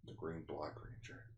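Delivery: low, hushed mock-grand declaration; solemn hero voice. Recapping a ranger's vow to transform, in a low hushed voice. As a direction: intone it softly and deeply.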